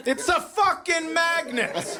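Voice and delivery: very high, slow exasperated mock answer. Comic snapping at a repeated kid's question, slow and high. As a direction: spell it out like the answer is obvious.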